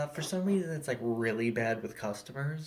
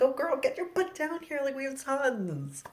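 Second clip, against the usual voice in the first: sky-high, sugary retail phone voice; fake friendly customer service voice; 14 semitones higher than usual. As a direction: gush at the caller like an overeager clerk. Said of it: "fake friendly customer service voice".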